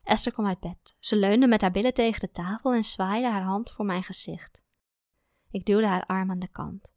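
ordinary delivery

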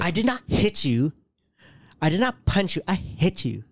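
hammed-up Amber Heard voice; imitating Amber Heard. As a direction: lay on the attitude, mock-defensive. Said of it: "the funny that you go into that voice".

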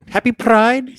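high, loud Irish leprechaun voice; Pride Leprechaun. Pretend. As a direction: chirp it like a gleeful leprechaun. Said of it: "says the Pride Leprechaun".

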